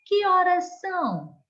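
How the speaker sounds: "que horas são?" bem mais agudo e mais forte, subindo como pergunta; entonação de pergunta. Shown as a demonstration. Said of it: "colocou uma entonação diferente para cada uma delas". (pergunta com voz aguda e marcada)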